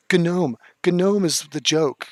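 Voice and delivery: mock the way they say "Gnome is the joke", stretching "Gnome" slowly; mocking pronunciation. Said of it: "Somebody pron-, pronounced it".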